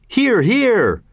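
high, hearty cheer of agreement; high-pitched shouted support; crowd shouts. A narrator's reading. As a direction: call out approval like a crowd. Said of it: "Shouts of".

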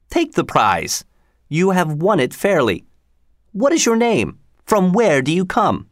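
a proud lord sneering at a beggar; pompous sheriff. Acted. Sheriff mocking the ragged stranger, haughty and high.

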